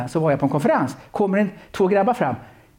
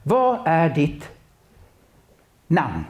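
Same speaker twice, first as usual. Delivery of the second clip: slow, drawn-out question with long pause before namn; unhurried, solemn asking; much slower than usual. Shown as a demonstration. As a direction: ask it slowly, letting the pause hang. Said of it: "för Jesus gör det".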